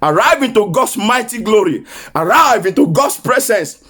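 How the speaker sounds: rising, ringing prophetic declaration; full-force preaching. Acted. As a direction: proclaim it over the congregation. Prophetic declaration, month of arrival.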